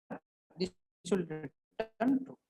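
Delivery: choppy, breaking-up audio; glitching audio. Real, not acted. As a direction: voice cutting in and out over a bad connection. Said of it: "Your voice is glitching".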